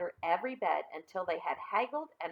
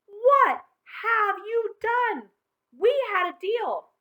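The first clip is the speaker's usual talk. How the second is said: angry yell; 13 semitones higher, 10 dB louder, much slower than usual. Acted. Mother finds toys everywhere.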